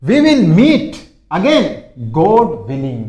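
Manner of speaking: very slow, high and loud at the start; slow classroom read; slow model sentence. Shown as a demonstration. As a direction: stretch every word for learners. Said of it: "इसको कैसे use करते देखिए".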